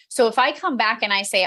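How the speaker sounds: ordinary talk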